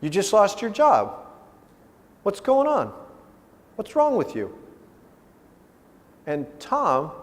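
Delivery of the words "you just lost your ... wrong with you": high-pitched fatherly dismay; father pressing his son for answers; concerned father. Retold or imitated. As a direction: switch to a worried dad, pitch raised. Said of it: "I'm putting on my father's hat".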